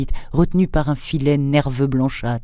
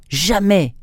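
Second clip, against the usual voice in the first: a defiant shout pitched higher; defiant cry; 6 semitones higher than usual. A narrator's reading. The marquis refuses his captor outright with a defiant, higher-pitched cry. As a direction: hurl the refusal back at the captor. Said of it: "cria le marquis".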